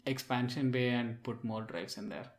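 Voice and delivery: usual speaking voice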